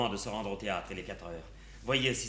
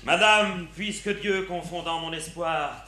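hammy stage actor; 6 semitones higher, 6 dB louder than usual. Acted. Tartuffe scene declaimed.

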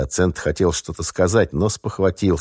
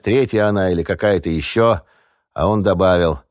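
ordinary delivery

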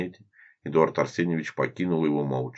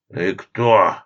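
loud demand; 3 semitones higher, 8 dB louder than usual. Acted. Boss demands who he is.

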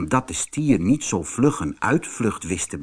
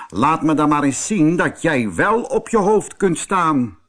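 angry; 6 semitones higher, 3 dB louder than usual. Acted. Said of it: "zei boos".

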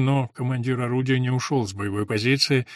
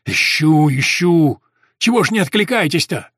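loud, aggrieved, higher voice; reproachful call; 3 semitones higher, 8 dB louder than usual. A narrator's reading. Messenger scolding the soldiers for not answering, loudly. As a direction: complain loudly after a long search.

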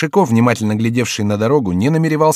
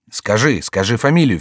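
plain reading voice

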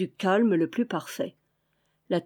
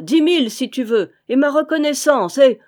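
louder, higher, urgent offer; eager exclamation; 6 semitones higher, 8 dB louder than usual. A narrator's reading. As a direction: raise the bid excitedly, climbing in pitch. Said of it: "s'écria Vaunois".

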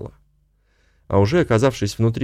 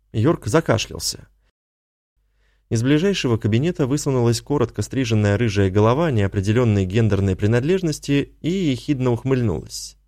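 usual speaking voice

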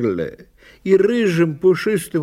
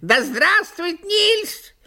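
crying magpie; 13 semitones higher than usual. A narrator's reading. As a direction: cry it out like a magpie. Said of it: "кричала она".

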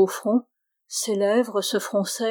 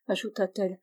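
ordinary delivery, nothing marked

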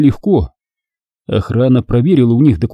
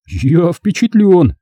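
slow, higher-pitched amazement; stunned; 7 semitones higher, much slower than usual. A narrator's reading. Thief awed by the Jedi's power, stunned. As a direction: draw it out, impressed and shaken. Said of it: "потрясённо качая головой".

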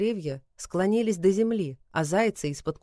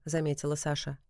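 usual speaking voice

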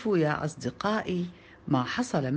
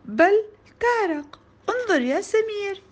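high, louder insistence; insistent child; 12 semitones higher, 4 dB louder than usual. A narrator's reading. Child contradicting classmate about the fish, insistent.

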